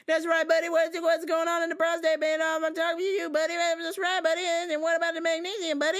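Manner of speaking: high, loud goofy buddy voice; annoying customer. Retold or imitated. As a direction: chirp it like an overeager regular. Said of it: "always calls me buddy".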